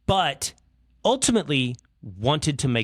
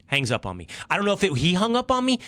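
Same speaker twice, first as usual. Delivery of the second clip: ordinary delivery, nothing marked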